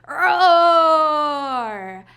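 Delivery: loud playful lion roar. Pretend. Librarian playing a lion for children, loud mock roar. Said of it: "what do they say".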